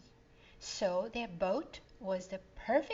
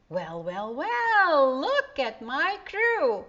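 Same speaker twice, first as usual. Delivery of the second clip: big rising teasing uncle voice, louder and higher; Uncle Joe teasing; 7 semitones higher, 11 dB louder, slower than usual. A narrator's reading. Uncle teasing the kids still in pajamas, loud and playful. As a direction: boom it out, amused at sleepy kids. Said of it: "says Uncle Joe".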